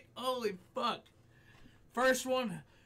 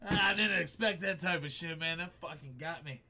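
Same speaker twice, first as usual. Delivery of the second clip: ordinary talk, nothing marked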